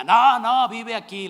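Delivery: un grito repentino y mucho más fuerte en el primer no; grito imitado. Retold or imitated. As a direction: corta de golpe al predicador. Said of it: "alguien grita". (alguien del público le grita al predicador que Dios vive aquí)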